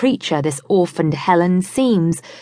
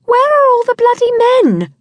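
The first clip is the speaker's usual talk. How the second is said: much higher and louder exasperated cry; high loud indignant exclamation; outburst; 6 dB louder than usual. A narrator's reading. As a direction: fling the question at the room. Scarlett bursts out at friends.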